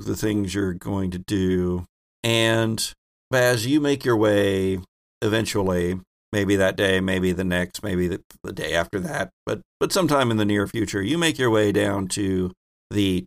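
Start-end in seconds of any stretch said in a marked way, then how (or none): none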